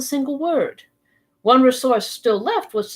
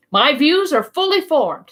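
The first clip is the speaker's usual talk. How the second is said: curt retort; 6 semitones higher, 3 dB louder than usual. Acted. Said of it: "Ned Land shot back".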